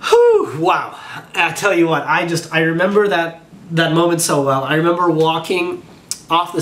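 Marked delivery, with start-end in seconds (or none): 0.0-1.0 a sharp high whew, then a choked wow; overwhelmed whew. Real, not acted. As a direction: let the relief burst out, then catch in the throat. Overcome reliving the big moment.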